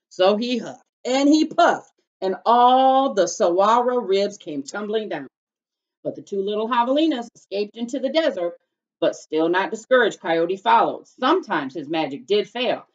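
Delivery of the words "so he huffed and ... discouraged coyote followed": dramatic narration. A narrator's reading.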